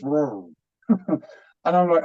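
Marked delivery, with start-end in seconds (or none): 0.0-0.5 low, soft, drawn-out "rrr"; calming down. Retold or imitated. Gentle guide stilling an animated man, soothing. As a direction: wind it all down in one falling sound. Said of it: "I'm getting animated, and he's just".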